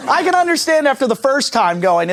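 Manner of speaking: ordinary talk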